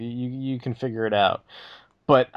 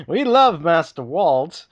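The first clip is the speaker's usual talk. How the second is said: high, loud, slow robot adoration; fawning droid; 8 semitones higher, 5 dB louder, slower than usual. Pretend. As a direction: gush like a servile droid. Droid parody.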